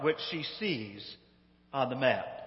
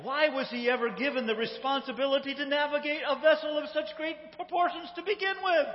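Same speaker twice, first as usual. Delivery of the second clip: high, outraged tirade climbing as it goes; hammed-up haughty indignation; indignant shout back; 8 semitones higher than usual. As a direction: fire back in mock outrage, higher and higher. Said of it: "she shouts back".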